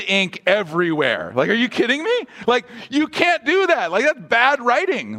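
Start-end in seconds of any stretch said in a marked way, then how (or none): 1.5-5.2 much higher, incredulous scolding; mock writing teacher tearing up the paper; exasperated teacher. Imagined writing teacher grading paper.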